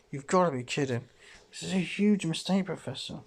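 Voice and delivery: ordinary talk, nothing marked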